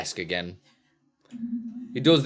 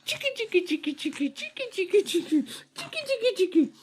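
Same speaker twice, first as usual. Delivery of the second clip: very high squeaky tickle chant. Real, not acted. Dad tickling his baby, squeaky high tickle voice.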